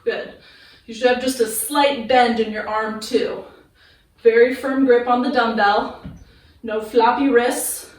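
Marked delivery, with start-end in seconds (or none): none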